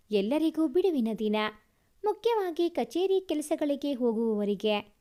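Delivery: ordinary delivery, nothing marked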